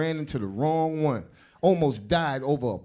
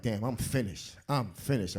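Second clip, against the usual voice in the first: quiet despair; 7 semitones lower, 9 dB quieter than usual. Retold or imitated. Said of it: "I'm like".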